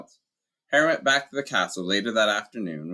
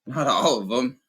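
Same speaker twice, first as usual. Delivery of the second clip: hoarse; 5 semitones higher, 4 dB louder than usual. Acted. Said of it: "said Hagrid hoarsely".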